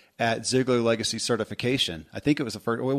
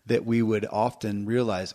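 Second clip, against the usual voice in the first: ordinary delivery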